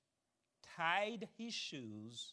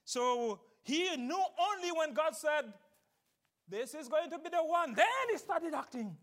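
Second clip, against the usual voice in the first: loud, high, fervent sermon voice; preaching at full force; 9 semitones higher, 10 dB louder than usual. Acted. Preacher driving the point home to the congregation, loud and high. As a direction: preach it hard and lift the pitch. Preaching Satan's attacks on Messiah.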